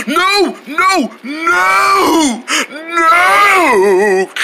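high, drawn-out wails of no; horrified cries. Acted. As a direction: melodramatic scream of despair. Juice is lost during chase.

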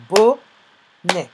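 "bonnet" in two separated syllables, BO... NNET. Shown as a demonstration. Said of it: "on l'entend dans la deuxième syllabe". (« bonnet » découpé en deux syllabes)

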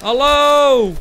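calling out. Acted. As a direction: call out to a friend out of sight. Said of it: "mijn vriend roepen of zo".

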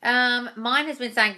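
usual speaking voice

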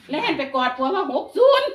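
high, loud blurt breaking into laughter; excited retold cry. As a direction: cry it out like panicked news, laughing. Retells blurting number to husband.

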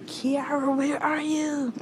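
high, loud call for someone missing. Pretend. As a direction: playfully call out for an absent classmate. Calling an absent classmate.

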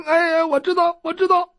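high, eager cringing yes; hasty agreement. Acted. Doctor agreeing to the heroes, high cringing voice. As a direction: agree fast, still scared.

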